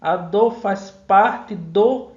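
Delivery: "A dor faz parte do" slow, each syllable set apart; syllable-counting scansion. Shown as a demonstration. Teacher counting the verse below, slow syllable scansion. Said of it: "a contagem aqui abaixo".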